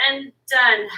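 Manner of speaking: ordinary talk, nothing marked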